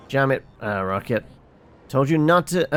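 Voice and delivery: ordinary talk, nothing marked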